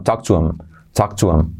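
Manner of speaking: "talk to him" said "talk tu 'em", no h; h dropped, linked. Shown as a demonstration. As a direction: glide "to him" into "tuem". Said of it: "se salta la H".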